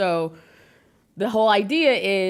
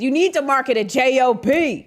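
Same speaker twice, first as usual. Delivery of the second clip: higher, louder scolding mother; stern mom; 5 semitones higher, 4 dB louder than usual. Retold or imitated. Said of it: "so she says".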